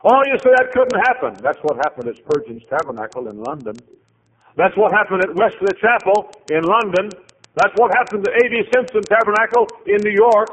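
high and loud preaching; full-force preaching. Acted. Preacher warns congregation about losing the church, loud and fervent. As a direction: preach it with rising fervor.

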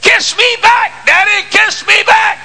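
put-on high, slow child's cry of daddy kiss me back; high shouted child's plea; pleading child. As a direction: beg like a little boy calling out. Child begging father in story.

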